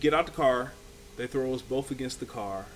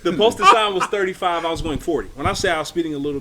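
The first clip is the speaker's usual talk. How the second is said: amused emphasis; 5 semitones higher, 12 dB louder, faster than usual. Real, not acted. Joke punchline about speeding.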